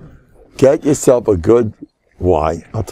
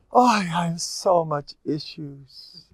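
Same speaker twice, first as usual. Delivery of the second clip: slow, soft, higher-pitched moan; mock lament; 4 semitones higher, 8 dB quieter, slower than usual. Mocking people who love having issues, mock lament. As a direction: moan it out slowly in mockery.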